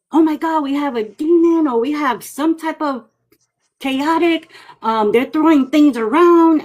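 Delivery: high, frantic voice of a scared client; panicked homeowner. Retold or imitated. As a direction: play the freaked-out homeowner, pitched high. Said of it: "they're like".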